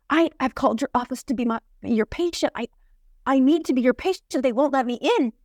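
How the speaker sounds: put-on light Brazilian accent, pushing to be a patient. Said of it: "Brazilian woman with this really thick accent".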